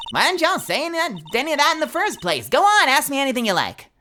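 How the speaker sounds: put-on Southern girl perking up at gossip; amateur Southern twang; attempted Southern accent. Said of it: "I don't do accents, but I try".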